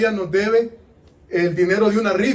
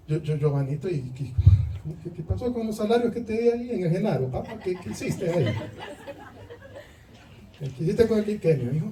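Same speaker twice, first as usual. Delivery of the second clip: soft sweet-talking lord, laughing; mock tender hushed questioning; mock gentle coaxing; 6 dB quieter than usual. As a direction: coax softly like a doting parent. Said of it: "El Señor no le va a hablar así".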